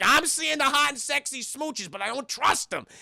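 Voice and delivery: high-pitched exasperated retort; mock indignant distrust. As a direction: insist on it, hammed-up and suspicious. Suspicious Bruce's acted retort.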